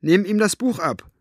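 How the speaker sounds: loud name, then a high-pitched order; calling out. A narrator's reading. Elinor tells Maggie to take the book, calling out. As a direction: call the child to act at once. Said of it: "rief sie ihm zu".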